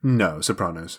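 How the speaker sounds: "Sopranos" with a broad ah, so-PRAH-noze, pitched low; corrective pronunciation of Sopranos. Shown as a demonstration. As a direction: correct them firmly: "Sopranos" with a long AH.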